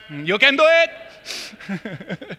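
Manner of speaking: teasing cheer. Real, not acted. Taunting volunteer with cash offers.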